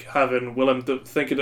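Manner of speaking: ordinary talk, nothing marked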